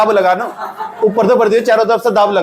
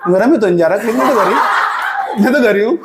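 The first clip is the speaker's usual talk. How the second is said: a youngster meekly backing down, drawn out; mock a show-off caught out, stretching the words upward; imitated backpedalling youngster; 3 semitones higher than usual. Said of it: "अपने घर वालों के सामने acting दिखा रहा".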